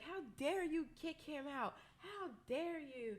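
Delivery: high-pitched mock outrage, no real volume; retold indignant yell. Retelling how she confronted the club staff, mock indignant yell. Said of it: "I start yelling back at them".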